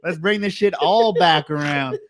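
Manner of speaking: ordinary delivery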